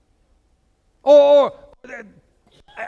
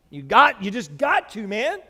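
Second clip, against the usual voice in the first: ordinary talk, nothing marked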